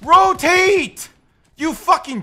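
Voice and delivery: high, loud barked order; angry shout. Real, not acted. Player ordering useless teammates to rotate, furious shout. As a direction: yell it at the team in real frustration.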